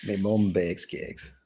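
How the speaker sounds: slow, mock Yorkshire accent. Shown as a demonstration. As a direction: draw out a light Yorkshire accent. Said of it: "Yorkshire, Yorkshire accent".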